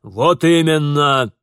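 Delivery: loud, drawn-out angry endorsement; emphatic agreement. Acted. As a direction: back him up at a shout. Father loudly backs his son.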